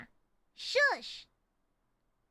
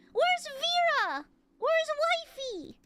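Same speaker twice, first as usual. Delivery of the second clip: excited calling; 11 semitones higher, 5 dB louder, slower than usual. Real, not acted.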